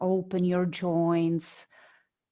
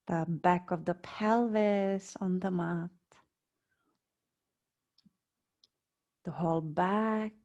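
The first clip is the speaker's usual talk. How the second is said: guided meditation. Real, not acted. Yoga teacher guiding students lying on mats, calm meditation voice. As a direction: lead them unhurriedly into body awareness, phrase by phrase.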